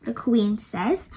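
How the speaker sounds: usual speaking voice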